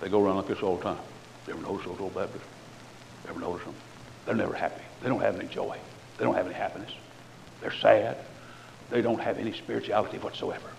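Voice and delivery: low, soft, fast mock gossip about Baptists; mocking sneering preacher. Imitating preachers mocking old Baptists, low and sneering. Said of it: "And then these preachers say".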